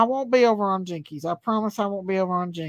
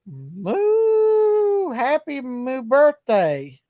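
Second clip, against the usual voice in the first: Daisy Cow; 2 semitones higher, much slower than usual. Pretend. Streamer wishing a child happy birthday as a cow, mooing. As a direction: moo it out like a cheerful cow. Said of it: "Daisy Cow says".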